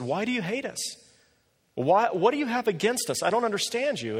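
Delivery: a hint of a hurt husband asking why; pleading husband. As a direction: ask it like a bewildered, hurt man. Husband pleading on hate hotline.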